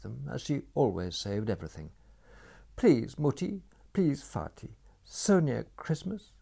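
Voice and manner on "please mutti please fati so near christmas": put-on high, pleading child's voice begging her parents; pleading girl. A narrator's reading. Graziella pleading with her parents near Christmas, high and begging. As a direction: beg sweetly for mercy, voice lifted high.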